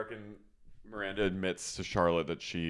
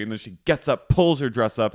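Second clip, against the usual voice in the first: ordinary talk, nothing marked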